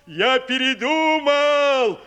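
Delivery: loud, slow wolf announcement. A narrator's reading. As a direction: call it out loudly as the wolf. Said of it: "сказал он так громко".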